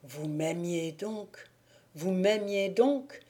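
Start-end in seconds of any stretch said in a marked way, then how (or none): 0.0-1.4 awed murmur. A narrator's reading. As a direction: breathe it in disbelief. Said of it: "s'écria Thibault".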